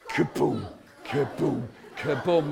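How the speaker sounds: low voiced thuds, one after another; imitated falling thuds. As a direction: imitate bodies dropping with low booms. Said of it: "The weak ones".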